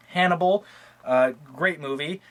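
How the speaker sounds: normal talking voice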